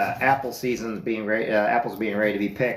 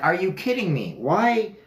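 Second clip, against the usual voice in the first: incredulous; 8 semitones higher than usual. Retold or imitated. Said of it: "I'm like".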